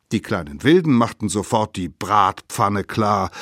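plain reading voice

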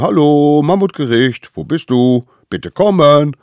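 high, loud calling voices. A narrator's reading. Little wild ones calling loudly for the court. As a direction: shout across the steppe.

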